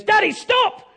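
put-on high, loud child's shout; outraged little boy crying stop. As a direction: yell it like a horrified kid. Child scolding dad in story.

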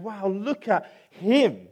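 slow, hushed-in-wonder mock gasp; staring up at the Gruffalo, amazed; awed animals. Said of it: "They're going".

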